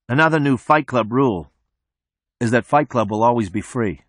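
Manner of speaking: usual speaking voice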